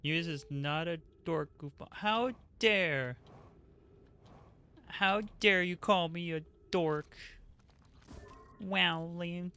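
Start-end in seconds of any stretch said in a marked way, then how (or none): 1.9-9.5 mock offended. Mock offense at chat's teasing.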